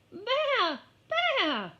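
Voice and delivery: high, loud bleating cry; lamb cry. Acted. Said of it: "cried the lamb".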